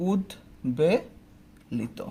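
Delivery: উদ্বেলিত drawn out very slowly, "ud-be-lito" with ব sounded; slow careful pronunciation. Shown as a demonstration. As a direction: stretch উদ্বেলিত syllable by syllable. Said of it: "যদি আমি বলি". (ধীরে ধীরে সঠিক উচ্চারণ)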